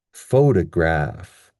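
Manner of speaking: "Photograph" slow, main stress on PHO; stress on first syllable. Shown as a demonstration. Teacher repeating the model, slow PHO-led "Photograph". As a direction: slowly hit PHO, lighter stress on graph in "Photograph". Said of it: "we have the stress at the beginning".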